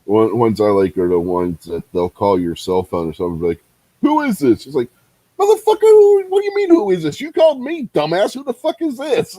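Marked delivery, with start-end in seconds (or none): 4.0-4.7 high, innocent "Who is this?"; mock caller question. Caller on the phone asking "Who is this?" in a high innocent voice. 5.4-9.3 high, loud outraged yell at the caller; mock furious reply. Man answering a scam call, hammed-up furious yell at the caller.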